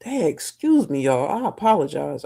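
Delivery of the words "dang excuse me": yawning. Real, not acted. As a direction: yawn pulling the words high. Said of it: "I start yawning".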